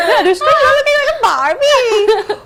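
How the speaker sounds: high, slow, laughing at herself. Real, not acted. Watching her own videos, high laughing giggle. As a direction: giggle through the Barbie line.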